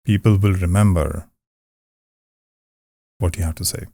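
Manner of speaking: deliberate pause for effect; dramatic pause. Shown as a demonstration. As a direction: pause after remember before the rest. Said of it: "You saw I pause there".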